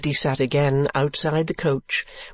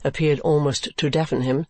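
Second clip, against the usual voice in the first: plain reading voice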